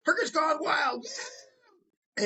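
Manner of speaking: high shouted cheer. Retold or imitated. Prostitute cheering the show title on cue, shouting high. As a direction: yell the title like a fist-pumping chant. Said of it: "What do you say?".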